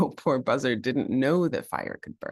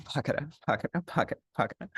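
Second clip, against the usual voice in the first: tired soft running; 3 semitones higher, 5 dB quieter, much faster than usual. Acted. Slowing down.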